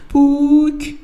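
very high woman's voice on the phone. Acted. A woman on the phone greets Buk in a very high voice. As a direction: call his name sweetly high.

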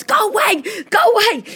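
put-on high, loud frantic shout at the kids; panicked yell. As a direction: yell them away from the fire in panic. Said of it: "I'm telling the girls".

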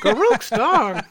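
high, loud squawking parrot. Retold or imitated. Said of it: "your parrot impression".

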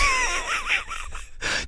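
a high, squealing burst of laughter; shrieking laugh. Real, not acted. Host shrieks with laughter at the guest's answer.

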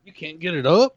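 retold jeer rising sharply at the end; mocking taunt. Co-host quoting the woman's taunt in the film, mock incredulous.

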